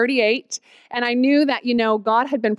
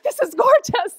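awed delight; 12 semitones higher than usual. Retold or imitated. Awed at Kilkenny Castle.

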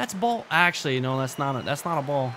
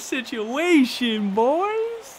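dramatic lament; 8 semitones higher, 4 dB louder, slower than usual. Real, not acted. Player frustrated at a tense at-bat.

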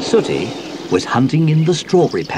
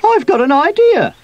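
very high, loud squeaky voice; excited squeak; 6 dB louder than usual. A narrator's reading. Sweep tells his friends he has an idea, squeaking. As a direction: squeak it out eagerly. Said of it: "squeaked Sweep".

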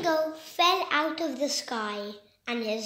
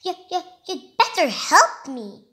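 stammering, then bursting out louder; blurting; 4 semitones higher, 8 dB louder than usual. Acted. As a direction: stumble over the first words, then blurt it. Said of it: "he blurts out".

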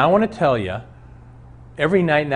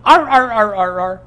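barking; 9 semitones higher, 6 dB louder than usual. Retold or imitated. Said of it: "the thing is at the bottom of the tree going".

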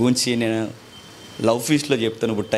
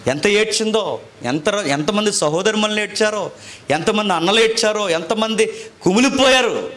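impassioned preaching; 9 semitones higher than usual. Acted. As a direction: pile up each line with rising fervour. Recounting who wept.

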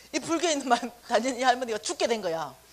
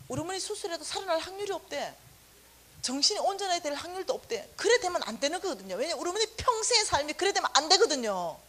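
usual speaking voice

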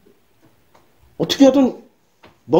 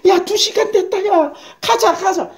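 high, hurried nag to go home; impatient nagging; 10 semitones higher than usual. Retold or imitated. Wife urging husband to leave after two hours. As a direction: nag like someone bored stiff.

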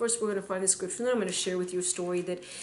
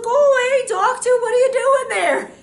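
startled outcry; 14 semitones higher, 10 dB louder than usual. Retold or imitated. Brother recognizes the run-over doctor.

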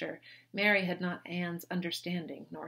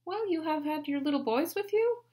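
higher, gentle enquiring sister; a touch higher, light Anne; Anne gently asking; 10 semitones higher, 4 dB louder than usual. A narrator's reading. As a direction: coax her kindly. Anne's gentle question.

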